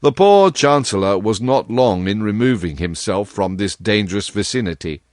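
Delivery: ordinary delivery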